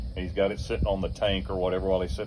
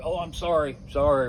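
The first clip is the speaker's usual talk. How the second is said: high, fake sorry; mock sorry, higher; mock apology; 8 semitones higher, 4 dB louder than usual. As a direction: imitate a smirking apology. Mocking driver's fake apology.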